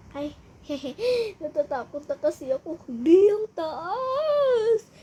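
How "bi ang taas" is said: whimpering. Acted. Crying on the scary ride.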